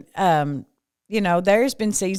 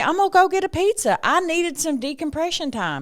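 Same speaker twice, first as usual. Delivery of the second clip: reassuring self-talk; 7 semitones higher than usual. Retold or imitated. Reassuring herself after cancellation.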